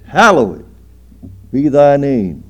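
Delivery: booming, drawn-out declaration; loud and high; loud proclamation. Real, not acted. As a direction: proclaim it to the back pew. Preacher declaims the prayer line.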